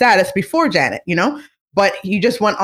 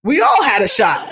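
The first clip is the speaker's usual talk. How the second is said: mock lament; 7 semitones higher than usual. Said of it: "And I was like".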